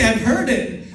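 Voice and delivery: ordinary talk, nothing marked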